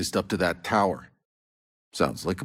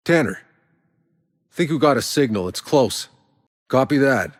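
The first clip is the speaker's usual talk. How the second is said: raised, higher, urgent radio call; 7 semitones higher, 4 dB louder than usual. Acted. Soldier calling to Tanner over the radio, raised and urgent. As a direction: call it in fast, fired up.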